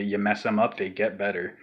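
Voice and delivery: normal talking voice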